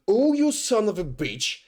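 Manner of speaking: high-pitched mock outrage; retold pissed-off. Lab worker to the woman who spat on him, pissed off. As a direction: curse in re-enacted indignation. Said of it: "I was so pissed".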